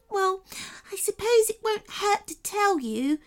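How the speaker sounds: high, sniffly fairy voice; tearful fairy. Acted. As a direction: still weepy after crying. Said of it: "The first fairy sniffed and wiped her eyes".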